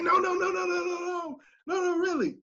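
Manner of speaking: very high, mock-frantic string of protests; panicked student. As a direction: imitate someone desperately heading off trouble. Imitates student frantically heading him off.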